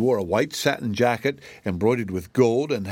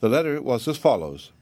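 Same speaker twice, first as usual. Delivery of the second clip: ordinary delivery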